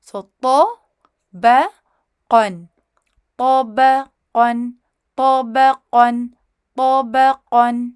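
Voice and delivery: پو ب قن spelled out, then joined slowly; syllables then joined. Shown as a demonstration. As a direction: piece the syllables together for students. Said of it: "توڑ کے join کریں".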